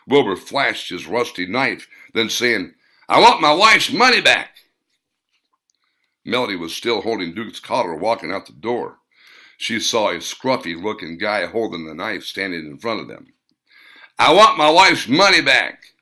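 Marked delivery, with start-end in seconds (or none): 3.1-4.6 loud, higher threatening demand; hillbilly with a knife demanding the money. A narrator's reading. 14.2-15.9 loud, higher threatening demand; hillbilly with a knife demanding the money. A narrator's reading.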